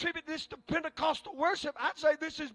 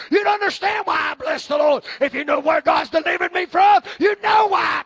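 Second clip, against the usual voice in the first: preaching building to full force; 4 semitones higher, 10 dB louder than usual. Acted. Preacher crying out his praise to the congregation. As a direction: build to a roaring climax.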